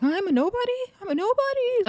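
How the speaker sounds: very high offended squeak; mock indignation. Acts out offended comeback.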